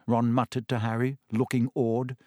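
usual speaking voice

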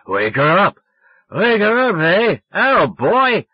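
put-on loud, high drunk insistence; drunken yelling. A narrator's reading. Drunk boy ordering grandmother to wake Sally, yelling. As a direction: holler down the phone like a drunk. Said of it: "I was so drunk".

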